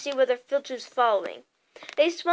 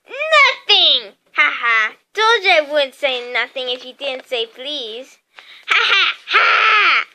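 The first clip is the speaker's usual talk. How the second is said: taunting poltergeist, triumphant; gloating; 8 dB louder than usual. A narrator's reading. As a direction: crow over the trick with spoken ha-has. Peeves gloats over tricking Filch.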